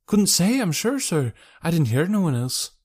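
high-pitched working woman voice; boatman's wife. Acted.

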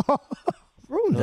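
jokey offended echo; mock surprise. Real, not acted. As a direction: play it like you can't believe the insult. Mock-offended reply to being teased.

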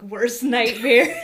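loud, slow laughing. Real, not acted. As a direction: laugh out the words. Laughs at creepy idea.

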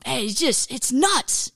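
ordinary talk

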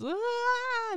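high squeaky imitated crying wail; mock ugly cry wail. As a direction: ham up a kid's ugly-face cry. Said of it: "ugly face cry".

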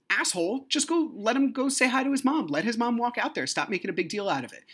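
high, hurried scolding voice; critical viewer. Acted. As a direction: voice an exasperated onlooker judging you. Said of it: "anybody who's watching this is probably thinking".

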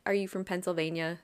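normal talking voice

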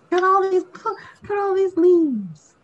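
high-pitched joking exclamation; mock exclaiming. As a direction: put on a very high voice, just kidding around. Joking exclamation, then "just kidding.

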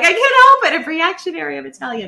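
high, loud, playful protest; mock excuse. Wife joking back at her husband, high and playful. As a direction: toss it off in a squeaky joking whine.

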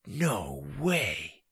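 soft, slow amazement; stunned disbelief. Acted. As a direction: draw it out, hardly believing it. Boy stunned by rescuers' arrival.

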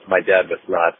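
usual speaking voice

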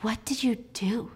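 breathless, unvoiced whisper of dread; horrified whisper. Alice, realizing what Jack did, in a horrified whisper. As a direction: whisper it as the truth dawns on you.

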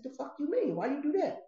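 shocked demand, retelling his reaction; teller's shocked reaction to the moved stash, high; shocked outrage. Retold or imitated.

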